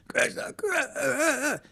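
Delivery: high, soft, slow choked cry. Shown as a demonstration. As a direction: let the name catch in your throat. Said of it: "your voice chokes".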